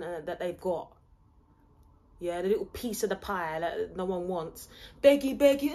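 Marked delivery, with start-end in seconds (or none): 5.0-5.7 loud, high singsong taunt; mocking taunt. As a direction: jeer it like a playground tease.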